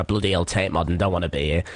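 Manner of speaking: low grumbling imitation of a mate; foul mood. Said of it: "John was in a foul mood".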